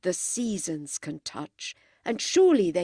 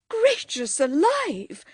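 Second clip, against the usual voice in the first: shocked exclamation; 9 semitones higher, 4 dB louder, slower than usual. A narrator's reading. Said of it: "cried Missus Honeychurch".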